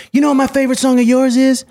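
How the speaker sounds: sing-song high question like Mike; high Michael voice. Retold or imitated. [name] asking about his favorite song, high.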